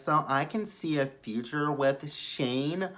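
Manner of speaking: high, slow mocking voice; Lacey impersonation. Lacey weighing Shane against John, slow high-pitched mimicry. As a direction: drag it out in a high put-on voice. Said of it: "you guys get Lacey's impersonation".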